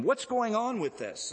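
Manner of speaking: acted questioning, pitched well up; high-pitched imitation of an interrogator; mock interrogation. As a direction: demand an explanation in a high, put-on voice. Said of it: "And they interrogate him".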